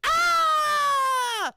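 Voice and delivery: high, loud panicked scream; mock scream. Pretend anchor screaming between segments, high loud scream. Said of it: "running back and forth screaming".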